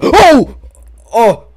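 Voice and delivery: sudden high, loud scream; real frightened yelp; startled scream. Real, not acted. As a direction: shriek at the jump scare. Jump scare.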